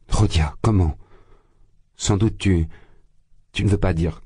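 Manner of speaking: alarmed, halting questions with pauses; start, falter, start over in alarm; anxious broken phrases; frightened. A narrator's reading. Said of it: "commença effrayée Pulcheria Alexandrovna".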